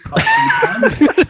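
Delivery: sudden high shriek of a laugh. Real, not acted. Watching his drunk friend's kitchen, a high shriek of laughter. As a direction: burst out in a screeching laugh.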